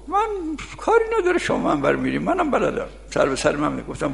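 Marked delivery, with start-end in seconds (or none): 0.1-2.9 put-on high, cocky old man's voice; squeaky high mock of a boastful old villager; old villager's boast. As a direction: brag like a proud village elder.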